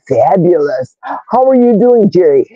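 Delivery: ordinary talk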